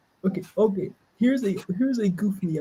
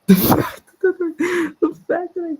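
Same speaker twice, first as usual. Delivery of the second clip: laughing; 9 semitones higher than usual. Real, not acted. Laughing at own line.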